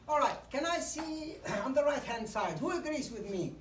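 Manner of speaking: engaging call to audience. Shown as a demonstration. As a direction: wake the room up with a question. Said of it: "Then quickly you say".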